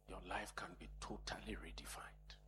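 soft hushed whisper; whispered promise. Real, not acted. Preacher whispering hope to congregation. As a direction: breathe the promise out.

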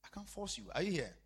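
ordinary talk

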